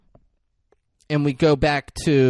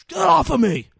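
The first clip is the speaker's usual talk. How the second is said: mock yell; 14 semitones higher, 6 dB louder than usual. Kid shoving him off.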